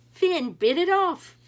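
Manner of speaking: loud thrilling storyteller reveal; excited reveal. A narrator's reading. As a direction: land the climax big. Climax of the story.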